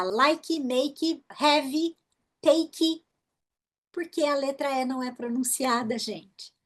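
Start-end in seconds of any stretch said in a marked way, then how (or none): 0.0-3.0 like, make, have, take each ending in an extra 'ee'; mock learner error, 'ee' tacked onto final consonants; Brazilian added final vowel. Shown as a demonstration. Teacher warning learners, extra 'ee' on like, make, have, take. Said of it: "nunca mais você vai falar".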